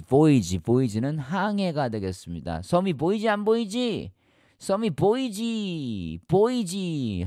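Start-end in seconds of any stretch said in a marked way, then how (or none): 2.6-7.2 high, stretched-out mock lookout call; calling out a sighting. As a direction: point out the island, drawing out the words. Replays sailor spotting island.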